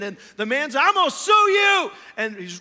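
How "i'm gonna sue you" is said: high, loud enraged outburst; acted-out shouted legal threat; threatening shout. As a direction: yell it like a furious dad. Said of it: "his dad is threatening the lawsuit".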